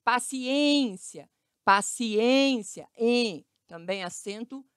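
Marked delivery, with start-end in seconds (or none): 0.0-3.5 Paciência very slow, held on the closed ê. Shown as a demonstration. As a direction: stretch out ên in Paciência, then isolate em.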